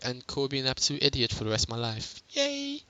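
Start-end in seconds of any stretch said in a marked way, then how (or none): 2.3-2.8 high, soft mock yay; a small squeaked self-mocking hooray; mock cheer. Mock cheer after calling herself idiot.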